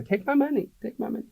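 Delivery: high, giddy rich buyer begging to spend; mock eager rich client crying take my money; rich shopper. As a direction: play a rich person who has lost their head over granite. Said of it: "They open their purse and they say".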